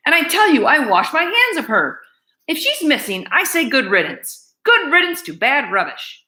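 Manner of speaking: shouting. Acted. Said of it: "Louise was shouting".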